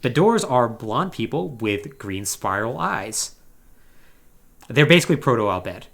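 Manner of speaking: ordinary delivery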